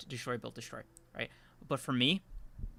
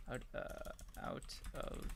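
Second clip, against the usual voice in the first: creepy whisper; 16 dB quieter, slower than usual. Acted. Spooky whispered show title.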